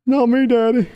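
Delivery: put-on timid boy answering his father; whiny little son. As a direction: beg your daddy not to yell. Son voiced denying to father.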